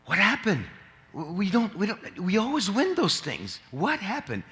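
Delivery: a mildly anxious cardinal demanding answers. Retold or imitated. Cardinals anxiously asking the bishops what happened.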